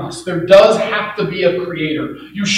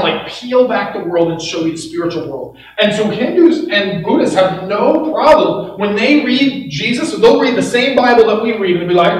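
ordinary delivery

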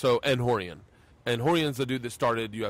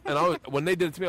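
normal talking voice

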